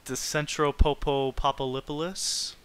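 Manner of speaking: slow, stammered-out Centropopopopolis with a questioning rise; mock mispronunciation. Streamer parroting the game's city name, mockingly mangled. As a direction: draw the name out with stuttered extra syllables.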